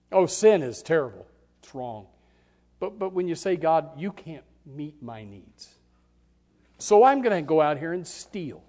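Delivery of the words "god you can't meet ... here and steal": sinner's defiance. Acted. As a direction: throw the excuse at God. Voicing the sinner's excuse.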